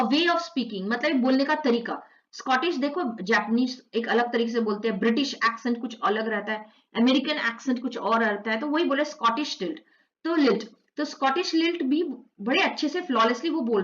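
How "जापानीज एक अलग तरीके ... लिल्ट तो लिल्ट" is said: normal talking voice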